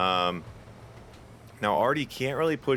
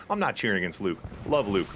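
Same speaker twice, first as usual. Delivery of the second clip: ordinary delivery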